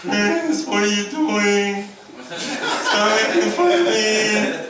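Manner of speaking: high, loud, slow mocking wife. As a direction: drag it out in a high whiny wife voice. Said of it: "that's my voice for her".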